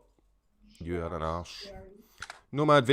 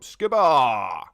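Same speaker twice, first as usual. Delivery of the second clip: loud, drawn-out call of the name Scuba; 8 dB louder than usual. Real, not acted. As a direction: shout Scuba across the room like a greeting. Greeting viewer Scuba by calling out.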